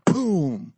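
loud imitated boom of a falling giant; booming impact. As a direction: punch out a big crash sound. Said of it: "giant falls".

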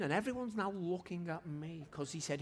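ordinary talk, nothing marked

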